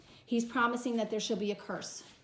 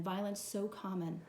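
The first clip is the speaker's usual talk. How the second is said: ordinary talk, nothing marked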